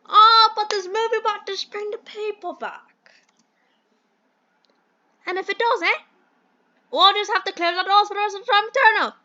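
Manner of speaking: high, loud theatrical British gent; bad British accent. Mentor pins his hopes on the movie, hammed-up British accent. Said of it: "do the worst British accent".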